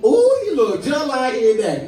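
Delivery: loud cooing Southern church lady; Southern auntie compliment. Retold or imitated. Comic gushing like a Southern grandma over a baby. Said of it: "that's another one".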